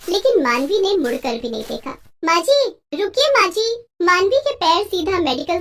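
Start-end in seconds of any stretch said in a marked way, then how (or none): 2.2-3.6 calling out. Acted. Calling after fleeing mother-in-law.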